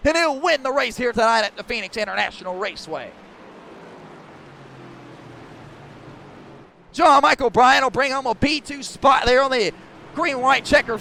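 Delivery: loud, driving motorsport commentary; live race play-by-play. Real, not acted. Announcer calls the last lap for stream viewers, live race play-by-play. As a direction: call the race at full force, punching the key words.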